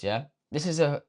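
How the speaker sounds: ordinary talk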